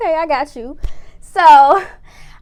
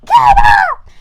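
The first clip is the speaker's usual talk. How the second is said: furious high shout, much louder; screaming; 13 dB louder, slower than usual. Retold or imitated. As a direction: yell at him to wake up, full force. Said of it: "I'm, like, screaming at him".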